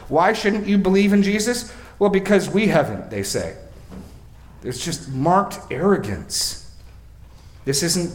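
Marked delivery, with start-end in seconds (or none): 0.1-3.1 high, loud smug reply; mock arrogant exchange. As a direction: play the smug Pharisee. Mock dialogue with Pharisees.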